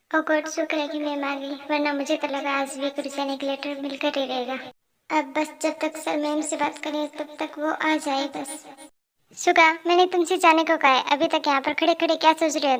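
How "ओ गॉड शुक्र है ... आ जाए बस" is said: soft, hushed thinking aloud; relieved private aside. Acted. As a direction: talk to yourself so the boss can't hear. Relieved private thought about colleague.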